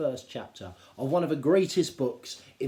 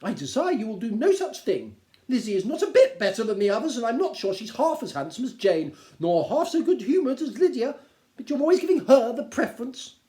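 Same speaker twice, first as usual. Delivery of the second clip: much higher and louder, complaining; indignant Mrs Bennet; 10 semitones higher, 9 dB louder than usual. Acted. Wife objecting to husband, high and aggrieved. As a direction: protest his favourite.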